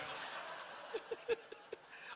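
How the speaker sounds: airy high-pitched giggle; high breathy laugh. Real, not acted. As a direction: let out a high wheezy laugh. Laughs at own joke. (tawa tinggi berdesah)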